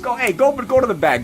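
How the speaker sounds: wiseguy barking his waiter off to the back, loud; shooing away. Acted. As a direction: send him off to the back sharply.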